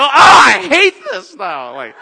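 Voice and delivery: high, loud, slow groaning complaint; frustrated shout. Retold or imitated. After hanging up with her, frustrated shout. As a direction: moan it out in frustration. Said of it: "I go".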